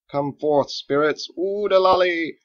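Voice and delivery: loud, high hammed-up chant, last word drawn slow; mock incantation. Cook playing wizard for the boy, mock incantation. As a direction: ham up a wizard's spell. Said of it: "chanting again, but a little less dramatically".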